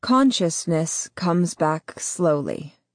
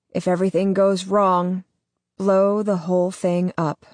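ordinary delivery, nothing marked

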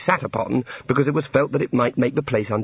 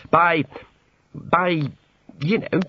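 halting, embarrassed stammer; 5 semitones higher, much slower than usual. A narrator's reading. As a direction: stall before the awkward word, pausing often. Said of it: "feeling a blush start".

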